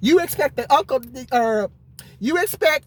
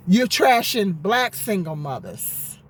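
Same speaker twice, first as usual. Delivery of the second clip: ordinary talk, nothing marked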